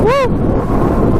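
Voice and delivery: high thrilled yell of speed; excited whoop. Real, not acted. Cheering at top speed.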